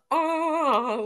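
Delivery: high, loud mock seagull cry. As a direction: imitate a squawking seagull. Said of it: "with, with seagull".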